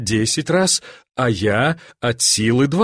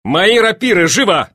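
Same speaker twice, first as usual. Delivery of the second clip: raised, urgent command; loud, eager master's order; shouted order; 6 semitones higher, 5 dB louder than usual. A narrator's reading. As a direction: call out briskly for service. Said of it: "закричал великий князь".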